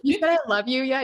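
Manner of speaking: loud, high, quick sibling tease; playful taunt, retold; teasing. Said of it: "teasing him about his girlfriend".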